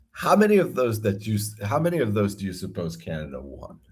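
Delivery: normal talking voice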